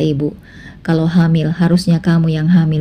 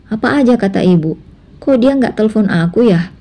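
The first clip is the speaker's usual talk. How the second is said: Anissa in a higher voice, confused and pressing; a young wife asking her husband in disbelief; puzzled Anissa; 5 semitones higher than usual. Acted. Anissa's voice in the story. (Anissa bingung, suara lebih tinggi)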